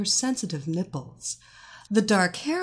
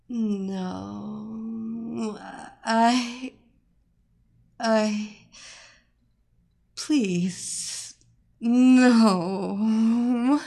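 faltering plea; 2 semitones higher, much slower than usual. Acted. Captive resisting the spell.

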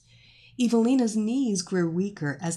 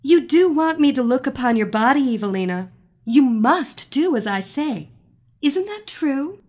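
commanding; 5 semitones higher, 7 dB louder than usual. Acted. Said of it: "The magician repeated her commands".

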